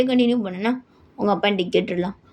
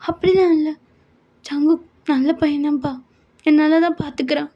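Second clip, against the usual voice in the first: put-on higher, girlish pleading daughter voice; daughter Yoon; 7 semitones higher than usual. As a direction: play the worried young daughter on the phone. Daughter reassures her father. (உயர்ந்த குரலில் கவலையான மகள்)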